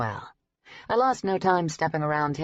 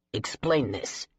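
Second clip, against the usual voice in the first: a lower, curt demand; curt lower-voiced demand from the boss; snapped; 4 semitones lower, slower than usual. A narrator's reading. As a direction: cut them off with a curt order. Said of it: "Bancroft snapped at them".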